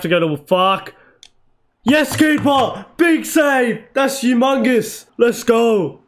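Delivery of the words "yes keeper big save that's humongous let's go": high, stretched-out cheers; excited cheer. Real, not acted. Gamer hailing his keeper's big save, excited cheering. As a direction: celebrate the save in punchy high bursts.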